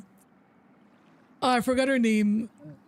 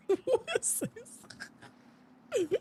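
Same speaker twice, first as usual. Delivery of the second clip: laughing whisper; 8 semitones higher, 7 dB quieter, much slower than usual. Real, not acted. Laughing at figure's shape.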